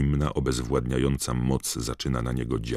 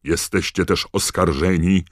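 high, loud accusation; thundering prosecutor; roaring; 6 semitones higher, 9 dB louder than usual. A narrator's reading. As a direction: roar it over the prisoner's cries. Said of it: "ryknął pan Crouch".

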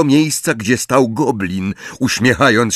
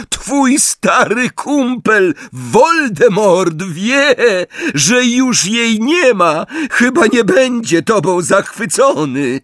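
high, slow, wild jeering; high-pitched manic mockery; gloating taunt; 12 semitones higher, slower than usual. A narrator's reading. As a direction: crow over a rattled enemy. Harry manically taunts Bellatrix.

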